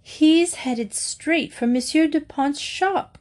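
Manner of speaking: high, drawn-out cry of alarm. A narrator's reading. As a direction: cry a slow, high warning. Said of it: "cried Missus Brown".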